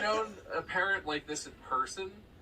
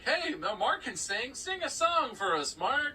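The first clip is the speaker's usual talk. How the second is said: higher-pitched, coaxing parent; proud pushy dad; 8 semitones higher, slower than usual. Retold or imitated. Dad asking his son to perform, high and coaxing.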